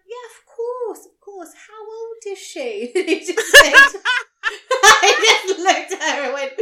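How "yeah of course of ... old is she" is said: high, slow sing-song nurse voice; nurse talking to a child. Retold or imitated. Nurse to the dad about his daughter, high cooing sing-song. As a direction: imitate a nurse cooing at a little kid. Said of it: "And the nurse just went".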